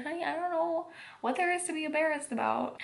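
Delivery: high-pitched imitated nurse; lifted, consoling voice of a nurse; reassuring nurse. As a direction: imitate a nurse soothing an embarrassed patient.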